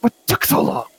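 high, sharp scolding; scolding boss. Acted. Wounded boss scolding the party for being late. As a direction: demand it, high and exasperated.

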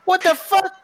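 very high-pitched squawk of disbelief; incredulous outrage. Acted. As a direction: shoot way up in pitch, acted shock. Red indignant about missing milkshake.

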